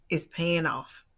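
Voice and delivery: ordinary talk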